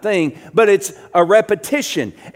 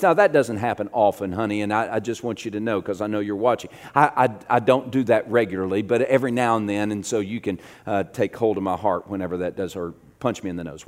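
lower, softer, hurried backpedalling; sheepish aside; 8 semitones lower, 6 dB quieter, faster than usual. Real, not acted. Preacher excusing himself to his watching wife, sheepish aside. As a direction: drop the voice and excuse yourself quickly.

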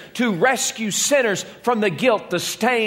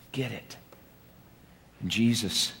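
ordinary talk

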